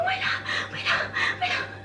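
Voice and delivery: high whispered call of my love; hushed calling out. Retold or imitated. Wife whisper-calling her husband about the missing plastic. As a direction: call softly, almost breathless, for your husband.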